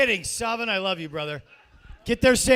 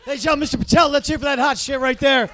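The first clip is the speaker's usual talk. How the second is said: loud, high club emcee call; announcer hype; 6 semitones higher, 13 dB louder than usual. Real, not acted. Host sending off the comic, loud announcer hype.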